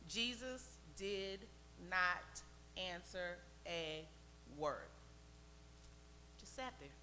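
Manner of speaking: slow, soft and low, word by word; hushed, slow emphasis. A narrator's reading. Preacher tells how Jesus ignored her, hushed and deliberate. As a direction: let the silence hang between the words. Dramatic telling of Jesus's silence.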